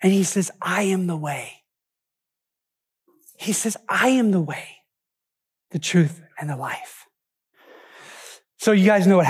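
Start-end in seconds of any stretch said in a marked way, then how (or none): 0.6-1.6 low, hushed answer; calm reassurance. Acted. Jesus answers a frustrated Thomas, calm and reassuring. 3.9-7.0 solemn reassurance. Acted. Jesus answers a frustrated Thomas, solemn and calm.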